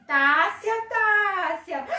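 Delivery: put-on loud calling of a child's name; solemn loud summons; voice of God. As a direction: call her grandly, stretched out. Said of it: "Poderia o Senhor nosso Deus falar assim".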